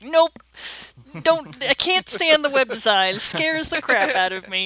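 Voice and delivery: loud, high mock panic. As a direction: recoil as if scared, half joking. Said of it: "that's one thing that's like".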